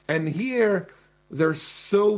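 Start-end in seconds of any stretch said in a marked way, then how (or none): none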